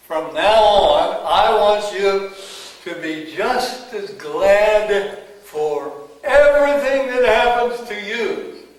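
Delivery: God's command. Retold or imitated. God telling Merlin to be glad, loud and commanding. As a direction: boom it out as a divine order.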